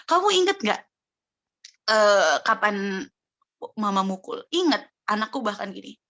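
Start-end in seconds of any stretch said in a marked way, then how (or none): 0.1-4.4 put-on like a mother coaxing a small child. Ibu bertanya lembut pada anak. 4.5-4.9 put-on small child's short answer, pitched up; child's voice, higher. Suara anak menjawab.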